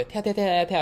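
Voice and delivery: happy skipping. Retold or imitated. Skipping happily to the office.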